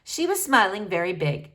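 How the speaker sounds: usual speaking voice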